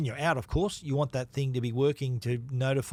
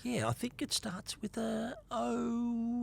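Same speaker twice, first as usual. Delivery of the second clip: high, soft, drawn-out pretend pondering; softly stretched, high-pitched fake uncertainty; mock guessing; 8 semitones higher, 7 dB quieter, much slower than usual. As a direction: play along, feeling slowly for the answer. Playing along, pretending to guess.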